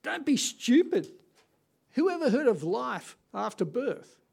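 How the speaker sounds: the twin in the womb mocking its sibling, loud and high; cartoon twin. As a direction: scoff like a know-it-all unborn baby. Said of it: "this is what this twin was saying".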